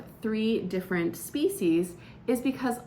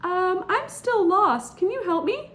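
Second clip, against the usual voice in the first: pleading lost stranger; 11 semitones higher, 5 dB louder than usual. Acted. Intruder pleads again.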